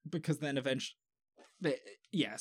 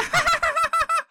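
very high, loud mock laugh; a cartoonish squealing laugh; imitated shrill laugh; 9 dB louder than usual. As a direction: copy her piercing laugh. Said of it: "laughs exactly like that".

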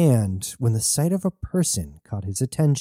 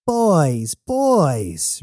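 higher, drawn-out falling purr; 5 semitones higher than usual. A narrator's reading. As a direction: purr it indulgently to calm the crew. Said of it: "Red purred".

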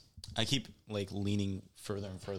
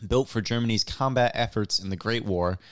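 louder, projected reading voice; louder news-story reading; news article read-aloud; 7 dB louder than usual. Real, not acted. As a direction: read the article out clearly to the room.

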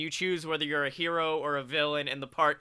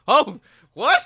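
surprised; 8 semitones higher, 10 dB louder than usual. Real, not acted. Shocked reaction to the joke.

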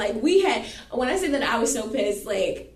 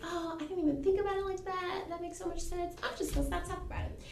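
high, soft imitation of a friend; retold agreeable friend; 8 semitones higher, 8 dB quieter, faster than usual. Friend backing down in the argument, high soft imitation. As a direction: play the friend softly conceding, pitched up.

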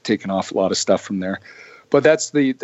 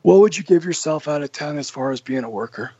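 imitating Johnny; 4 semitones higher than usual. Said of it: "He goes".

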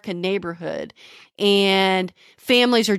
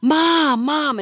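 calling out; 7 semitones higher than usual. Retold or imitated. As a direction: shout for mom from the doorway. Brother calls his mother again.

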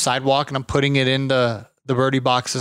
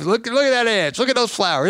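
higher voice showing off his yard; proud old man; 9 semitones higher than usual. Retold or imitated. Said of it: "he's showing off".

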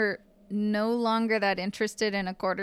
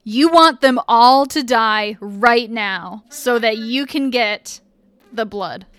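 menacing; 9 dB louder than usual. Acted. GM voicing rage-filled inner thoughts.

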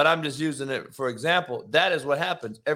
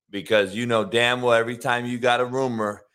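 ordinary talk

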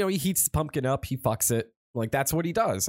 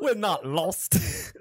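slow, higher mock Jamaican accent; Jamaican Dracula accent; 4 semitones higher, much slower than usual. Boyfriend denying he is lost, mock Jamaican Dracula accent. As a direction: drag it out in a silly Jamaican accent. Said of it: "Yeah, Jamaican Dracula".

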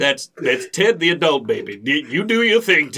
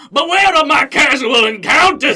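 preacher shout; 9 semitones higher, 5 dB louder, faster than usual. Acted. Preacher-style proclamation.

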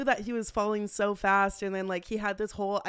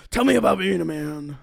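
mock manly voice; 9 dB louder than usual. Teasing gruff manly voice.

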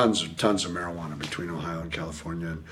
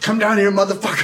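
cop yelling; 10 semitones higher, 4 dB louder than usual. Retold or imitated. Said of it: "He went".

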